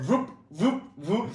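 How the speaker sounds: high, springy whooping noises; imitated takeoff whoops. Athlete acting out his takeoffs off the table, high imitated whoops. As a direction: sound out each launch with a high whoop.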